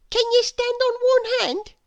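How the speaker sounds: put-on bright childlike schoolboy voice; young William. A narrator's reading. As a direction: pitch it up like an eager small boy. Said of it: "he asked".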